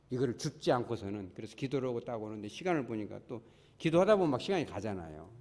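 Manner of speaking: ordinary talk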